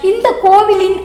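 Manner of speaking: ordinary delivery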